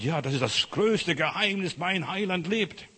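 imitated brother's jubilant answer; exultant reply.

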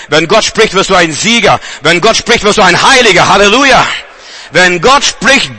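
normal talking voice

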